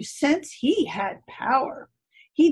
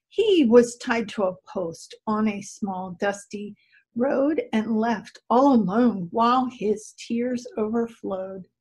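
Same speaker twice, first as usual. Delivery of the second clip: ordinary delivery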